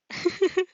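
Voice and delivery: short high giggle; embarrassed giggle. Real, not acted. Reader fumbling a line, short high giggle. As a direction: giggle at own slip.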